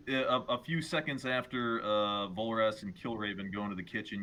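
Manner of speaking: ordinary talk, nothing marked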